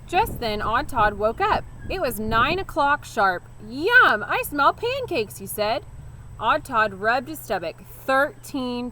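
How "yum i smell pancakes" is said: excited. A narrator's reading. Todd smells pancakes, excited.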